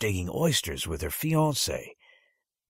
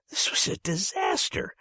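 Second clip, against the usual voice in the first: high, breathless whisper of dismay; panicked whisper; 11 semitones higher than usual. A narrator's reading. Boy realizing his name was skipped, panicked whisper. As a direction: gasp it out in horror.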